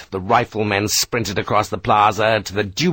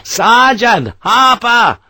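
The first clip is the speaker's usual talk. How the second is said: slow, loud, high calling; drawn-out call; 11 semitones higher, 6 dB louder, much slower than usual. Acted. Sharp searches for Harper, calling desperately. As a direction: stretch the name out across the distance.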